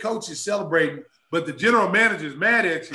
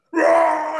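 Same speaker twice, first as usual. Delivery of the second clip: a high, loud angry roar; loud mock roar; 9 semitones higher, 9 dB louder than usual. Angry teammate charging him, a loud high roar. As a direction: roar as you lunge at him.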